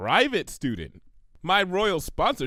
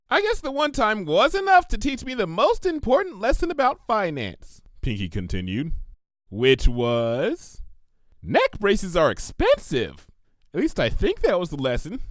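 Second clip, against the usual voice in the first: bubbly pony; 6 semitones higher than usual. Acted. Narrator voices Pinkie.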